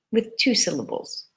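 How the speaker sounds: normal talking voice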